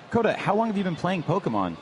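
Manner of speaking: ordinary talk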